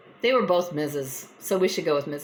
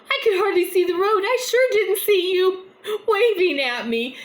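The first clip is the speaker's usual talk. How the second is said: much higher and louder, fast amused protest; high mock voice; 13 semitones higher, 5 dB louder than usual. Mrs Jones tells Mrs Smith she never saw her wave, high and loud. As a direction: pitch way up as the tiny lady defending herself.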